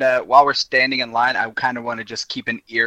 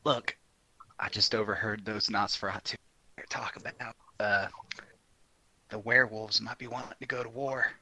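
hushed, much softer in-character aside; quiet confiding; 2 semitones lower, 15 dB quieter than usual. Acted. Character tapping Valerie's shoulder to share gossip, quietly confiding.

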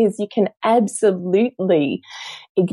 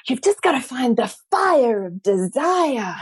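performer pig coaching; 5 semitones higher than usual. Retold or imitated. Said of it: "turns around to her and goes".

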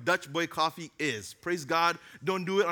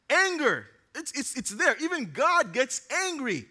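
raised preaching; 6 semitones higher, 3 dB louder than usual. Real, not acted. Preacher builds on anger.

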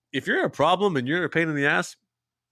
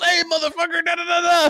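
much higher, louder imitation of a rant; mock yelling; 5 dB louder than usual. Neighbor coming up to the truck, mock yelling. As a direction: holler it like an irate man, dissolving into gibberish. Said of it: "pull up and yell".

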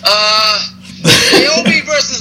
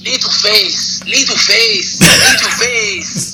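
drawn-out, badly faked Japanese accent; terrible Nakamura accent; much slower than usual. Podcaster mimicking a wrestler backstage, terrible Japanese accent. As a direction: stretch each word in a hammed-up mock Japanese accent. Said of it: "That was a terrible accent".

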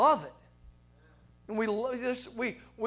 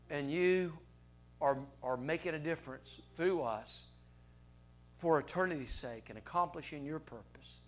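closing prayer; 5 semitones lower than usual. Acted. Pastor closes with a prayer.

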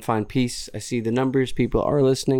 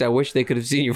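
ordinary talk, nothing marked

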